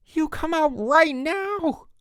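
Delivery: high, slow, loud demand; furious yell. A narrator's reading. Counselor yelling at camper hidden in cartons. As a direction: yell him out of hiding. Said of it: "he howled".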